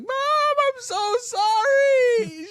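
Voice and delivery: loud, high, drawn-out mock wail; bawling apology. Son apologizing to mom from the station, hammed-up bawling. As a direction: bawl it out slowly, hammed-up crying. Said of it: "I was crying".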